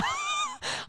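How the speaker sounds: squealing high laugh. Real, not acted. Laughing at the story.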